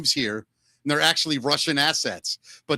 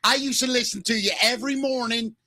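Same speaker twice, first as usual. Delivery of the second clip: high, mock Baltimore woman's voice; Baltimore accent; 6 semitones higher than usual. Host as Baltimore woman flirting with Mickey, high Baltimore accent. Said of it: "worse than the Baltimore accent".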